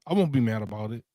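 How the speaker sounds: usual speaking voice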